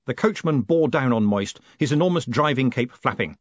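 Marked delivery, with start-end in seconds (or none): none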